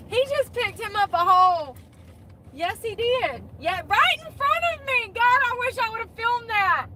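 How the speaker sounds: high and loud, giddy; shrieking with delight; excited gossip. Real, not acted. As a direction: spill the tea squealing.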